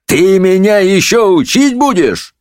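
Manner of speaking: high, slow indignant challenge; outraged drawn-out question; thundering judge. A narrator's reading. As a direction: thunder back in affronted disbelief. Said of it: "загремел судья".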